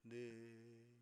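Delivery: soft, level, drawn-out syllables; mock syllable-by-syllable reading; exaggerated slow reading. Shown as a demonstration. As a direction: stretch each of "글, 의, 네" separately. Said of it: "이게 아니고".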